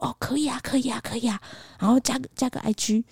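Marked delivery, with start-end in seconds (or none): 0.1-1.4 softer, half-mumbled agreement; quietly agree, almost under the breath; mock quiet reply. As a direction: waiter softly agreeing to shop for them. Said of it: "他讲超小声的".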